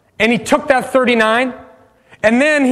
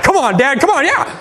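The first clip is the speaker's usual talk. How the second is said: eager urging; 4 semitones higher than usual. Retold or imitated. Eagerly urging his dad on.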